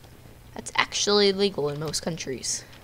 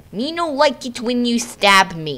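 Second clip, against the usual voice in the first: caveman complaint; 4 semitones higher, 5 dB louder than usual. Pretend. Caveman-speak complaint at attacker.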